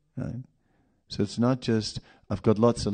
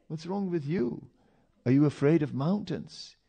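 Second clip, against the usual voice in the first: puzzled question; 7 semitones higher than usual. Retold or imitated. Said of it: "I go".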